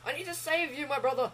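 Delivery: mock heroic declaration. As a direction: play the noble rescuer. Rushing to save teammate.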